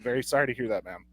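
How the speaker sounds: a quiet, snide brush-off; condescending. Retold or imitated. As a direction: make the sympathy sound fake and cold. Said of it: "I was very condescending".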